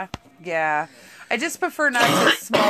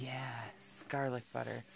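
whispered yes; 6 semitones lower, 17 dB quieter than usual. Real, not acted. Whispered agreement about garlic butter.

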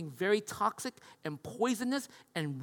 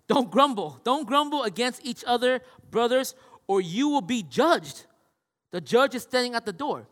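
raised, emphatic Bible reading; scripture reading; 4 semitones higher, 6 dB louder than usual. Acted. Pastor reads the verse to the congregation, emphatic.

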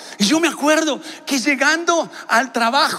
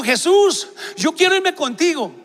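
higher-pitched retold plea; pleading cry; 7 semitones higher than usual. Young man left behind calls to Jesus, pleading. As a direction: call out to Jesus, voice raised in pitch.

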